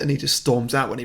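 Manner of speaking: usual speaking voice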